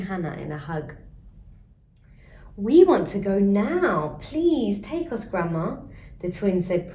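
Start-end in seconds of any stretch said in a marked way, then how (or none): 2.6-5.8 louder, slow, begging; pleading eagerly. Acted. Twins beg Grandma to take them now, eager and pleading. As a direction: drag it out like tugging a sleeve. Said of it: "pulling on Grandma's dress".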